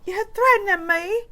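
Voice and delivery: high, hammed-up indignation; mock indignant movie voice; Trading Places line. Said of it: "in Trading Places".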